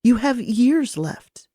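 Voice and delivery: trembling voice. Acted. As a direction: hold back tears, mildly. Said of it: "My voice trembled".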